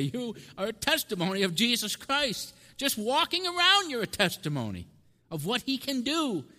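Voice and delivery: high, loud preacher's exclamation; fervent declaration. Retold or imitated. Preacher to a transformed staff member, fervent declaration. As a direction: proclaim it with rising fervour.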